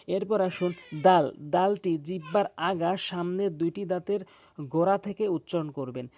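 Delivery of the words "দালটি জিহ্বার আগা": normal talking voice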